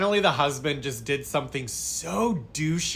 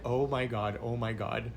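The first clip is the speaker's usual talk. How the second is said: dreading under breath; 3 semitones lower, 7 dB quieter than usual. Retold or imitated. Dread at the clients' arrival.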